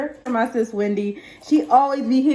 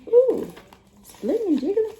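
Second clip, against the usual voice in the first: delighted coo; 10 semitones higher than usual. Real, not acted. Reacting to jiggly item.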